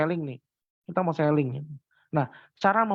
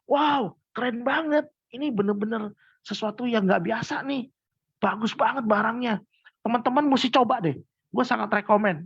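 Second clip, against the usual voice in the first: excited rave; 5 semitones higher than usual. Shown as a demonstration. Seller switching from doubt to excited recommendation. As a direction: gush like you just found a miracle product. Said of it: "Terus tiba-tiba kayak".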